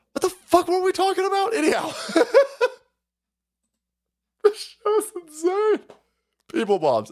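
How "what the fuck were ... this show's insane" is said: high, laughing through the words; giggling, losing the thread. Real, not acted. Host laughing at his own show. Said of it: "laughing aside".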